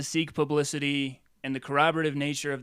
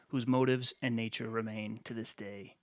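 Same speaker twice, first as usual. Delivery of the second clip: low, soft fade into a whisper; hushed mystery; 5 semitones lower, 7 dB quieter than usual. A narrator's reading. Host closing the book summary on a mystery, hushed and fading. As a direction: let it sink away to a whisper. Reading the book's haunting closing question.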